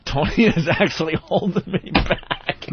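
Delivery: high, drawn-out words through laughter; laughing. Real, not acted. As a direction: tell it while cracking up. Laughing while recalling being held back.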